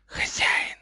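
slow, whispered serpent hiss; hissing snake. A narrator's reading. Runespoor urges its master, hissing whisper. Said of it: "шипел настырный рунослед".

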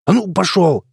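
high-pitched shooing yell. A narrator's reading. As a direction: yell it to chase an animal off. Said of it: "заорал он". (крикнуть, прогоняя)

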